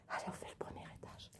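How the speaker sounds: soft unvoiced whisper throughout; library whisper. Real, not acted. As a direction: keep it hushed, exploring in the dark. Whispering while exploring the house.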